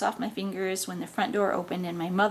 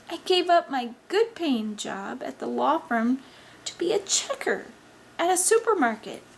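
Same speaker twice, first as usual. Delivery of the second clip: exasperated reproach; 3 semitones higher than usual. Acted. Mother reproaching son for her sacrifices, exasperated.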